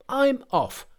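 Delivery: very high, petulant monkey voice; high-pitched huff at normal volume; huffy Monkey. A narrator's reading. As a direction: end the outburst high, without extra volume. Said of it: "he flared up at once and cried".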